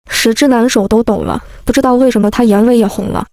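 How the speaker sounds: ordinary delivery, nothing marked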